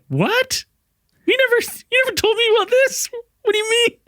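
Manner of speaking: squeaky falsetto mock-upset girl. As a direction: protest in a very high put-on girl's voice. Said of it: "She's, like, upset".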